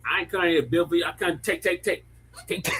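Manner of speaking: put-on a lightly done African accent, the way she said it. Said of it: "Hey, [name], do that accent".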